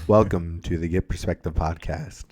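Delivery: low, smooth late night DJ intro; mock radio host voice. Shown as a demonstration. Friends ask for a radio voice, so he does a smooth DJ intro. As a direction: croon it like a jazz station host. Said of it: "Do some for us".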